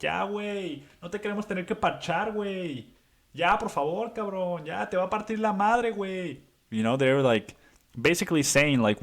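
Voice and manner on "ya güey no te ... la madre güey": Mexican friends pleading. Retold or imitated. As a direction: beg him to back off in Mexican Spanish, pitched up. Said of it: "His Mexican friends were like".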